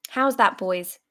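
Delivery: usual speaking voice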